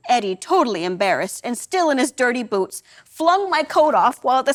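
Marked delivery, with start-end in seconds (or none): none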